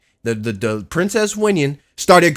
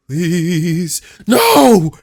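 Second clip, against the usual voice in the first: mock plea to cry; 4 semitones higher, 5 dB louder than usual. Streamer reenacting Odysseus begging Poseidon, please into a cry. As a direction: imitate the plea turning to a cry. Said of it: "I love the".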